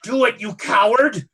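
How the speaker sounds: loud contemptuous shout; Madam Lin yells at Mister Wu, angry shout. Acted. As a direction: bark at the coward to do it. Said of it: "Madam Lin is just looking at him going".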